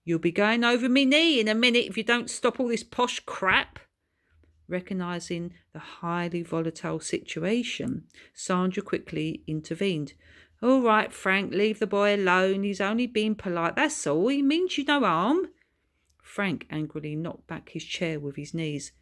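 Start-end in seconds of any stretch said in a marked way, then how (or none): 0.0-3.8 louder, higher threatening father; angry dad. Acted. 10.6-15.5 louder, higher soothing mum; calm him down quickly; placating wife. Acted. Mother calming angry husband.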